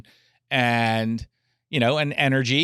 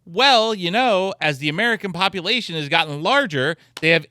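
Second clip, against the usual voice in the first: quoting the expert; 8 semitones higher, 3 dB louder than usual. Retold or imitated. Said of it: "the T-shirt expert said".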